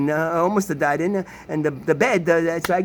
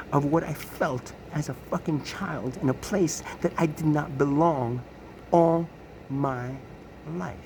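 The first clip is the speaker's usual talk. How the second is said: normal talking voice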